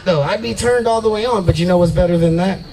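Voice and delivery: ordinary talk, nothing marked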